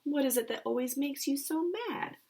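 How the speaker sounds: high sad little bird voice; a small bird pleading, worried; sad warbler. Acted. Warbler asking Jake why he's mad, high and sad. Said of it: "looking sad".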